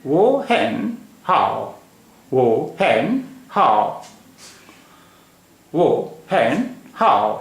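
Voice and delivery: वो हैन हाओ very slowly, word by word; Mandarin model pronunciation. Shown as a demonstration. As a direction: stretch each tone of वो हैन हाओ, pausing between words. Teacher models Mandarin phrase slowly.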